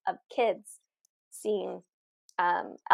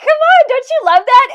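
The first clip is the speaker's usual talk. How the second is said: high, loud retold excitement; delighted; 12 dB louder than usual. Reader shows a client the matching guidebook line, delighted. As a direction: re-enact gushing over a perfect confirmation. Retells delighted reaction to card confirmation.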